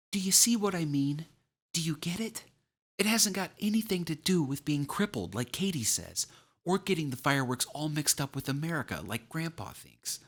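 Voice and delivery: put-on high-pitched, pleading child's voice; anxious young boy. A narrator's reading. Boy explaining himself to his uncle, anxious child voice. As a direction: plead to be understood, like an upset boy. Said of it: "looked at Uncle Al anxiously".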